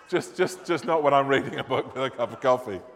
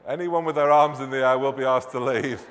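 normal talking voice

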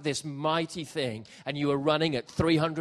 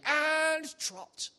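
high, drawn-out riding instructor call; friend shouting commands; 12 semitones higher, much slower than usual. Retold or imitated. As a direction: call the command out across the beach. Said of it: "my friend is shouting".

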